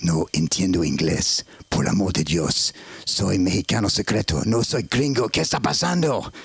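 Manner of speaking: very low put-on Mexican voice, climbing towards the end; deep mock-Mexican Spanish. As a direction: play a secret Mexican, starting deep and rising. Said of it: "I'll do a Mexican voice".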